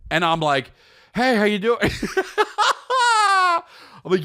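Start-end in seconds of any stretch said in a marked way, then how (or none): 1.1-1.8 high chirpy faux-innocent greeting; mock innocent cheer. Greeting his wife in the ER, falsely cheerful. 1.8-3.6 real high burst of laughter; breaking up laughing. Real, not acted. Laughing at his own innocent act, high.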